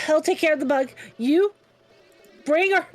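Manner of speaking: usual speaking voice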